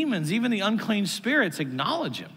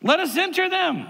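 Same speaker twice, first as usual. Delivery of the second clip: stretched, insistent begging in a reading; 10 semitones higher than usual. A narrator's reading. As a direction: voice the demons pleading, pitched way up. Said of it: "they begged him, saying".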